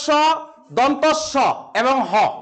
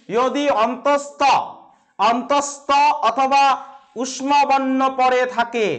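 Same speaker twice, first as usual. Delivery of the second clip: slow dictation; much slower than usual. Shown as a demonstration. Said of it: "teacher dictating the rule slowly".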